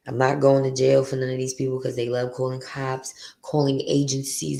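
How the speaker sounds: usual speaking voice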